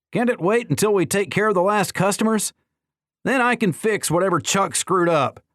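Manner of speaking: high, carrying complaint; loud complaint. A narrator's reading. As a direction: call it out for everyone to hear. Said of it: "loud enough for the whole world to hear".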